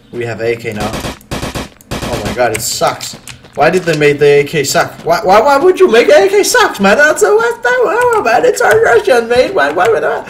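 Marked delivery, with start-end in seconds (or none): 5.0-10.2 high-pitched exasperated rant; exasperated whining. Real, not acted. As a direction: whine in disbelief, pitch way up. Complaining about the game's weak gun.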